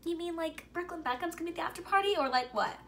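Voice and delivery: high, fast rush of disbelief; excited disbelief. Retold or imitated. Friends hearing about the after party, high excited disbelief. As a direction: blurt it out in giddy surprise. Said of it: "We're like".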